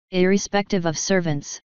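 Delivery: ordinary delivery, nothing marked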